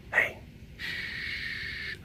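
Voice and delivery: normal talking voice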